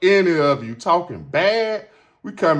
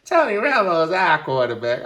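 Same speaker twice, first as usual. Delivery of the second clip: mock shocked fan; incredulous what; 5 semitones higher than usual. Fans answering him, shocked and high. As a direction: squeak out the disbelief. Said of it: "They were like".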